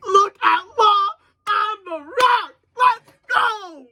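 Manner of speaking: high, loud excited yelling; excited shout. Real, not acted. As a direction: cry out in pure hype. Excited manga reaction, shouting.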